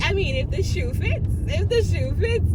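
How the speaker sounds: light smug, lilting retort; sassy reply. Retold or imitated. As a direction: toss it off with mock vanity. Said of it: "And I'm like".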